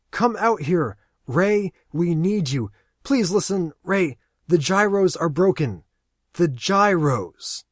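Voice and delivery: loud, high and desperate; pleading shout. Acted. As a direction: beg him at full voice. Aaron begs Ray over radio.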